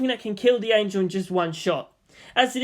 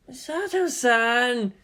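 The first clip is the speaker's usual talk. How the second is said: drawn-out call; 6 semitones higher, much slower than usual. Acted.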